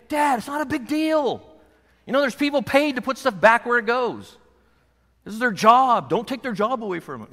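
mock the kids talking back to dad; kids' protest. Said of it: "my kids will tell you".

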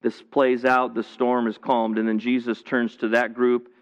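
ordinary talk

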